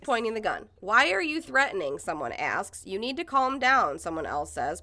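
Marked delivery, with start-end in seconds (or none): 0.8-2.0 raised, higher-pitched demand; challenging. Retold or imitated. Protester asking the gun-holder, challenging. 2.8-4.0 higher-pitched firm call; commanding. Retold or imitated. Protester to woman with gun, commanding.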